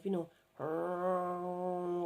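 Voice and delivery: long droning trumpet-like hum; imitated strange noise. Shown as a demonstration. Vlogger imitating strange noises heard in space, droning hum. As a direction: hum out a steady, eerie drone. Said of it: "Like a kind of".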